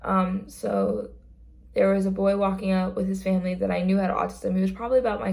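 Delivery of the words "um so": usual speaking voice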